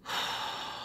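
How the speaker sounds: long, soft exhale of dismay; long sigh. Real, not acted. Dismayed by the announcement, a long soft sigh. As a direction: let out a drawn-out sigh.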